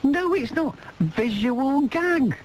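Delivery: slow, high, exasperated insistence; host arguing with caller about a visual gag, high and slow; high drawn-out protest. Real, not acted. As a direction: plead the point up high, stretched out.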